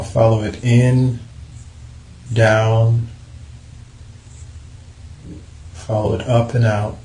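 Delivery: unhurried, even meditation guidance with long pauses; calm body-scan voice, leaving space between instructions; guided meditation. Acted. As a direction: lead them calmly through relaxing the body, phrase by phrase.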